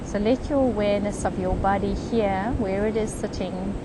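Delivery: unhurried, even meditation voice with long pauses; guided meditation. Real, not acted. Meditation leader guiding a group on the beach into comfort, calm guided meditation. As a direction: lead them gently, phrase by phrase, leaving space.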